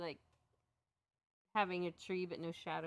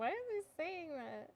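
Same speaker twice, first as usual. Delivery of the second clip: mock puzzled; 9 semitones higher than usual. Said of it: "I was like".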